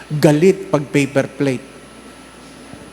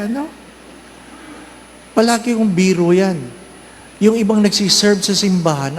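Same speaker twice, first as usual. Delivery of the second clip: ordinary talk, nothing marked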